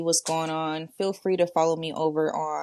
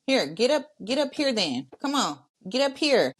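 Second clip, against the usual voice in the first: higher, coaxing call; calling a pet; 7 semitones higher than usual. Real, not acted. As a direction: call your pet up to you, pitch raised. Calling a pet up beside her.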